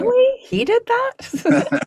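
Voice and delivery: high, slow incredulous gasp; mock amazed. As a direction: stretch it out in playful disbelief. Reacting to the listener's quoted amazement.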